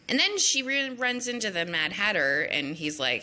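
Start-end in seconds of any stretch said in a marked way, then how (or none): none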